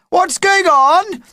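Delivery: very high, loud shout. Acted. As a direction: call out it from the audience. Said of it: "shouted someone in the audience".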